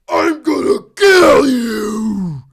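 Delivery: slow, loud, drawn-out villain threat; evil villain voice. Shown as a demonstration. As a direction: bellow it like a cartoon villain. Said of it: "do your best evil villain voice".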